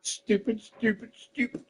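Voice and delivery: self-scolding murmur. Real, not acted. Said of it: "self-reproach".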